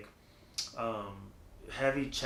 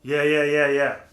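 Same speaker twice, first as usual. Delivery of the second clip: much louder, heavy chest tone; 10 dB louder than usual. Shown as a demonstration. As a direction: power it from the chest. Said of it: "Let me see if I can do it like that".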